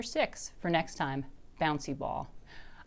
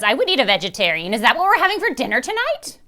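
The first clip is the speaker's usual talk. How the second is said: loud, rising hungry witch voice; a witch eyeing her dinner; greedy witch; 7 semitones higher, 10 dB louder than usual. A narrator's reading. Witch asks what's for dinner, loud and eager.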